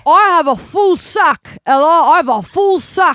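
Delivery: loud, high, boastful proclamation; Santa. Acted. Santa boasts to the co-host, hammed-up shouting.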